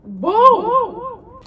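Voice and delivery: high, loud, drawn-out whoa; exaggerated loud disbelief; shocked whoa. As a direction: stretch out a high, stunned whoa. Shocked at the boyfriend reply.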